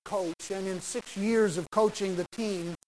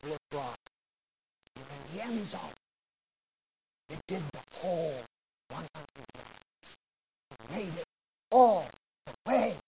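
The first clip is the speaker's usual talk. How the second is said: softer, hushed reveal to the exhausted player; quiet coach; 3 semitones lower, 5 dB quieter than usual. Retold or imitated. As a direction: lower your voice, let him take it in. Coach reveals the end zone.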